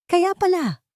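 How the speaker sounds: high, sly villainess line under her breath; a knowing, wicked realisation; lightly voiced scheming woman; muttered to herself. A narrator's reading. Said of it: "bulong niya sa sarili".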